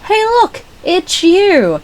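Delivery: high, loud teasing call; teasing dad. Retold or imitated. Dad teasing his teenage daughter about Violet, high and loud. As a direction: tease like a dad pointing at the screen. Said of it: "My dad would turn around and go".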